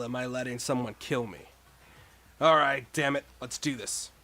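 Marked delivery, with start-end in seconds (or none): none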